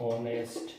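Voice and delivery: ऑनेस्ट starting on the vowel; ऑनेस्ट with no h before the first vowel; silent h. Shown as a demonstration. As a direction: drop the h of honest: ऑनेस्ट. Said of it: "एच फिर silent है".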